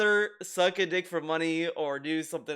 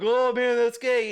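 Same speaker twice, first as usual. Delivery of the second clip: louder, lifted teasing quote; mock teasing; 4 semitones higher, 6 dB louder than usual. Streamer mimicking people ribbing his friend, mock teasing. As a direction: imitate a crowd ribbing someone.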